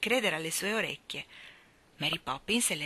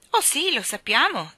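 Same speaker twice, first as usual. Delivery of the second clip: put-on high, bright-eyed friendly child; 8 semitones higher, 7 dB louder than usual. A narrator's reading. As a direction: answer eagerly, louder and higher. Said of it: "col tono più amichevole". (tono amichevole e vivace)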